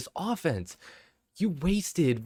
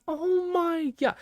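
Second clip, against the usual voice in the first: stunned disbelief; slower than usual. Real, not acted. Reacting to the stats.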